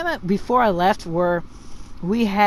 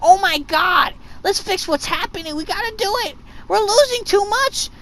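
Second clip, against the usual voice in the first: high, panicky whiner imitation; hammed-up complaining clanmates; mock whining; 10 semitones higher than usual. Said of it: "they whine about getting their ass whooped".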